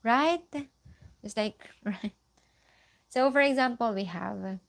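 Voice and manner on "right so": ordinary talk, nothing marked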